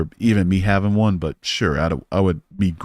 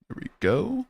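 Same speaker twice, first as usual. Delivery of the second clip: singsong; 13 semitones higher, 4 dB quieter than usual. Real, not acted. Singsong success.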